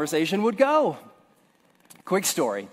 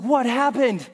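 dazed question; 5 semitones higher than usual. Retold or imitated. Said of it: "I was like".

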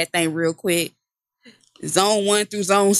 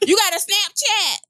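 put-on Jamaican accent; 11 semitones higher, slower than usual. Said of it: "I can't do a Jamaican accent".

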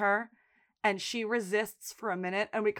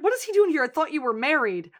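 high, loud indignant outburst; outraged quote; 6 semitones higher, 6 dB louder than usual. Retold or imitated. Lip seeing Jimmy arrive, outraged loud demand. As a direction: bark it out in outrage. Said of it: "And he's like".

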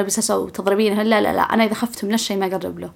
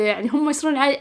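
normal talking voice

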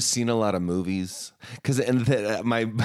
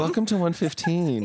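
podcast host voice; 9 semitones higher, 3 dB louder than usual. Pretend. Podcast intro bit.

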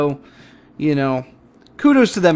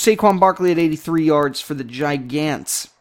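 ordinary delivery

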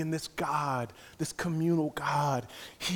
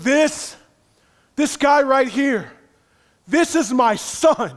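God's voice; 9 semitones higher, 10 dB louder, slower than usual. Retold or imitated. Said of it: "God's voice bellowing down to him".

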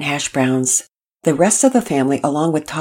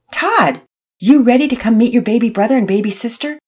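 cheerful dad voice; 7 semitones higher, 5 dB louder than usual. Acted. Mister Hicks cheerful dad voice.

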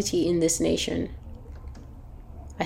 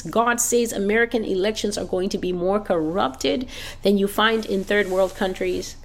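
ordinary talk, nothing marked

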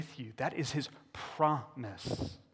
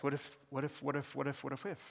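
fading worry; 8 dB quieter than usual. Retold or imitated. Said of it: "anxious spiral".

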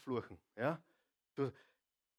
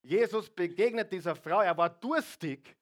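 ordinary delivery

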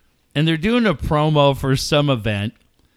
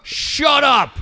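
very high mock yell; mock heckling; 11 semitones higher than usual. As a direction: cap the wrestler act with a high outburst. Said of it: "telling everybody in Padres Twitter".

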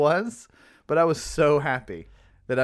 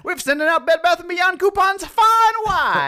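put-on high, loud old-timey ad shout; 13 semitones higher, 5 dB louder than usual. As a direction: belt it like a 1950s ad. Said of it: "anytime that voice starts".

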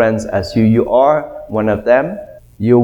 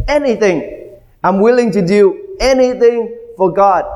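eager pledge; 7 semitones higher, faster than usual. Pretend. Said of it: "You would say".

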